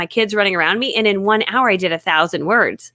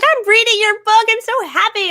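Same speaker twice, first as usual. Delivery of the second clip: much higher, louder squeal of delight; imitating a giddy, beaming author; gushing author; 12 semitones higher, 4 dB louder than usual. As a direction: squeal it like a thrilled fan.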